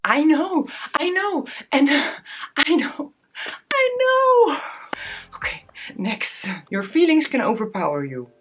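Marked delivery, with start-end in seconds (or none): 0.0-4.6 high, rising toward a sob; crying. Real, not acted. Vlogger admitting the advice is right, rising into crying. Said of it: "crying over advice". 5.4-6.5 unvoiced whisper. Real, not acted. Vlogger collecting herself after crying, whispering. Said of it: "whisper after crying".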